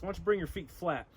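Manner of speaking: normal talking voice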